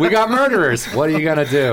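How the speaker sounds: high, punchy slogan pitch; hammed-up proclamation; mock state slogan. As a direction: boast it like a silly state motto. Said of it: "Is that their slogan?".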